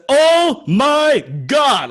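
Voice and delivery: high, slow scream of disbelief. Retold or imitated. Fan spotting his hero in the room, high slow scream. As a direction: scream it out from the top of the lungs. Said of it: "I was just screaming".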